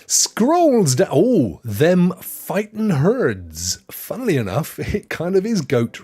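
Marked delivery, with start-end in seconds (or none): none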